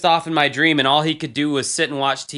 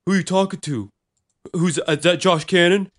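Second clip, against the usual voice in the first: put-on a kid butting in on a call, pitched up a little; nosy little brother demanding the phone; lightly voiced little boy; 3 semitones higher than usual. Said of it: "this little boy voice in the background".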